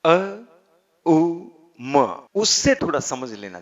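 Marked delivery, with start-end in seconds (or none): 0.1-2.3 अ, उ, म each said as a separate raised syllable; syllables apart. Shown as a demonstration. Teacher spells out Om's sounds for students, slow and separate. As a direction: break Om into its three sounds, one by one. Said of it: "ओमकार का उच्चारण".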